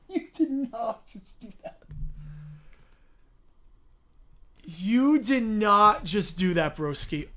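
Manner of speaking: laughing in disbelief; overwhelmed, half crying; shocked laughter. Real, not acted. Reacting to huge gift.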